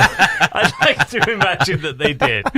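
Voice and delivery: laughing mock Queen; lingering mock Queen. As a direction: stay in the Queen's voice while laughing.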